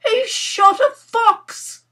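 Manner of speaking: slow, very high, loud wail; horrified wail, drawing out each word. Acted. Nora horrified at the dead fox, wailed. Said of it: "she wailed".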